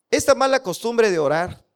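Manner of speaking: ironía marcada en mala, subiendo al final; sarcástico. Shown as a demonstration. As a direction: dilo con burla, como si orar fuera un vicio. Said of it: "estoy hablando en forma sarcástica". (con tono sarcástico)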